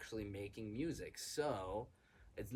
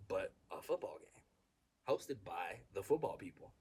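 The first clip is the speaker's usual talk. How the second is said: ordinary delivery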